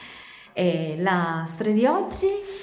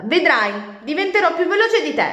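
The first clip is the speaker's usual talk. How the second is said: much higher, louder vow; defiant shout; 11 semitones higher, 8 dB louder than usual. Acted. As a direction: squeal the promise in anger. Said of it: "gridò Nicole infuriata". (grido di sfida)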